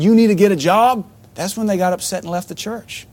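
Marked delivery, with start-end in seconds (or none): 0.0-1.1 louder, higher retold scolding; mock stern command; raised scolding demand. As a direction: bark the demand like a pastor laying down the law. Pastor retells telling them off.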